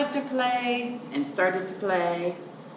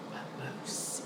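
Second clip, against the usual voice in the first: softly whispered aside; 17 dB quieter than usual. Real, not acted. Reader whispering to the children, softly. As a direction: whisper the word.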